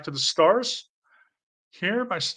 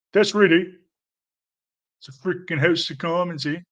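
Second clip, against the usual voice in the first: loud, higher-pitched hammed-up Canadian; exaggerated Canadian accent; 3 semitones higher, 4 dB louder than usual. Shown as a demonstration. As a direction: lay the Canadian accent on thick. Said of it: "This is doing too much Canadian".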